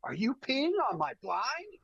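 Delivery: high-pitched disbelieving question, rising; mimicked incredulous outrage. Friend to guide peeing on his blind, incredulous. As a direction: imitate an appalled friend, voice way up.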